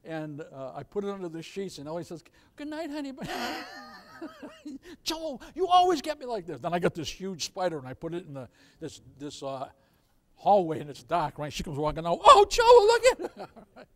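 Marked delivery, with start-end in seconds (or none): 2.5-3.1 soft, higher-pitched wife voice; imitated wife. Wife saying good night before prank, imitated wife voice. 5.0-6.6 higher-pitched mock complaint; imitated pranked wife. Wife to prankster husband, mock complaint. 12.2-13.9 high, loud imitated wife's shriek; mock fright. Wife seeing fake spider in dark hallway, mock fright.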